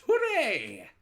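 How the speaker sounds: high, loud triumphant cheer; witches' hooray. A narrator's reading. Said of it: "all of us witches shout".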